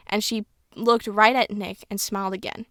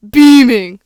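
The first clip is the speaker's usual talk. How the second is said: sudden loud outburst on one word; big emphatic repeat; loud exclamation; 14 dB louder than usual. Real, not acted. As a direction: blurt it out at full volume. Mocks a word from the story.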